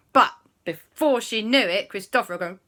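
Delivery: plain reading voice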